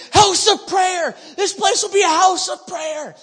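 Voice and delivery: high, loud, stretched prophetic shout; crying out. Retold or imitated. Contending in prayer over the building, crying out. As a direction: declare it at full force over a building. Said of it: "contending and crying out over this building".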